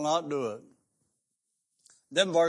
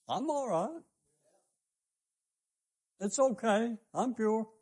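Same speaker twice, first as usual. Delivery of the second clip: higher, mocking voice of the self-satisfied; mock sinner; 7 semitones higher, slower than usual. Preacher mocking sinners who say they're fine, high smug voice. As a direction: mimic them brushing it off, high and smug. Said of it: "what they're saying is".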